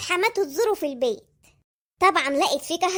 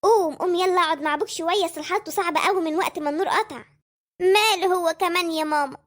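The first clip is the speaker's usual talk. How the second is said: normal talking voice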